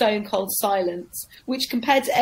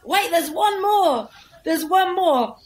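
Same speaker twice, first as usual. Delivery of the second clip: much higher, slow, laughing plea; frantic MC; 9 semitones higher, 3 dB louder, slower than usual. Retold or imitated. MC begs the crowd to stay for one more act, high. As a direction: squeal it like a panicking host. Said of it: "he went".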